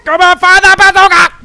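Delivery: loud, high, impatient yell. Real, not acted. Player urging his bazooka to fire, yelling. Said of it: "the reason I'm yelling it".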